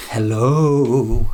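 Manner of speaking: spooky hello. Pretend.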